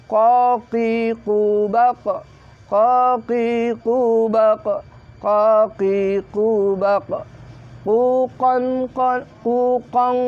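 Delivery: slow chanted "Qaqiqubaq" with back-of-tongue Q; qaf pronunciation drill. Shown as a demonstration. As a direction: hold each vowel of "Qaqiqubaq" long. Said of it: "Wah, ini qaf". (melantunkan "Qaqiqubaq" pelan)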